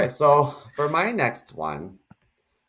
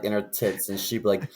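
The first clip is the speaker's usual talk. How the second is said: ordinary talk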